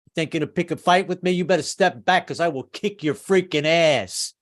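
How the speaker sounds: a light tough-guy New York accent, picking a fight; New York tough talk, squaring up to a stranger. Shown as a demonstration. Comic demo of how New Yorkers pick fights, in a light tough New York accent. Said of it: "put on that New York accent 'cause it always sounds tough".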